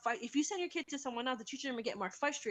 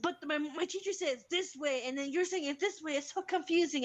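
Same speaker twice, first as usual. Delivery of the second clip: higher, mildly exasperated child imitation; retold upset kid; 5 semitones higher than usual. Child objects to parent's different method, mildly mad. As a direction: protest like a confused, cross kid. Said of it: "the kid got mad".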